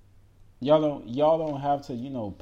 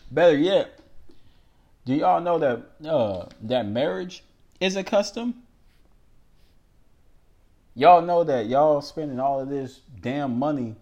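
louder challenge; 4 semitones higher, 6 dB louder than usual. Real, not acted. Preacher rising to a challenge.

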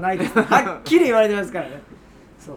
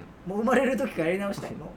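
deflated; 5 semitones lower, 5 dB quieter than usual. Real, not acted. Crushed comedian wishes redo.

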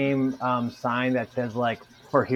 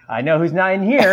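louder, higher mock announcement; teasing announcement; 6 semitones higher, 8 dB louder than usual. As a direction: call it out like a gag. Imagined text, teasing loud.

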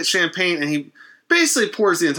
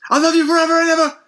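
high, fast, louder eerie sing-song; creepy love vow; 5 dB louder, faster than usual. Retold or imitated. Dying wife vowing love to husband, high eerie gush. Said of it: "Very, uh, very unsettling".

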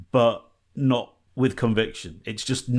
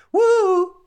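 very high, loud whoop; theme song whoop; 7 dB louder than usual. Pretend. Host breaking into the DuckTales theme, with a very high loud whoop. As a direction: sing out the cartoon theme whoop.

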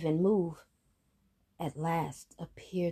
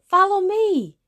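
high, loud shout; 11 dB louder than usual. Acted. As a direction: yell for them to follow. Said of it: "The youngest brother shouted".

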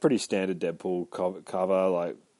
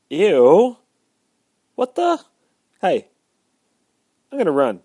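high startled yelp; 9 semitones higher, 12 dB louder than usual. Retold or imitated. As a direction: jump at the sudden threat. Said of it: "And he's like".

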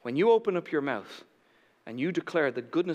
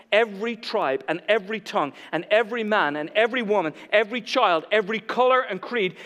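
high, loud, rhythmic roll call; driving preacher's cadence, rising in pitch; impassioned preaching; 6 semitones higher, 5 dB louder than usual. Real, not acted. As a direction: build the list with mounting force. Preaches at full force.

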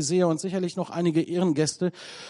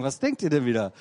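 lower, teasing scold; mock reproach; 4 semitones lower, faster than usual. Real, not acted. Preacher mock-scolds the laughing congregation. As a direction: pretend to scold them playfully.

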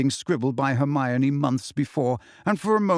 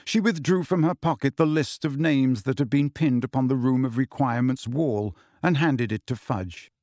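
ordinary delivery

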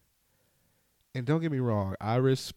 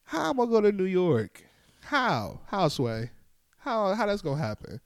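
mock outrage, higher; exasperated rant; 8 semitones higher than usual. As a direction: push back in disbelief. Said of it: "I'm looking like".

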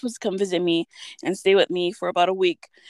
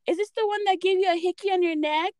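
lightly voiced mom, higher pitch; quick, higher-pitched nosy question; imitating mom; 9 semitones higher, faster than usual. Mom quizzing daughter's date, lightly imitated. Voicing mom's awkward question.

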